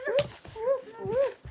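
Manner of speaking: high-pitched play woofing; playful dog woofs. Pretend. Playing dogs in the house, high puppy woofs.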